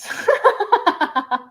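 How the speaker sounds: bright burst of laughter; high laugh. Real, not acted. Laughing at a chat joke.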